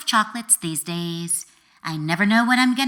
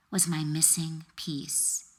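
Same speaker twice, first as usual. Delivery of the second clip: ordinary delivery, nothing marked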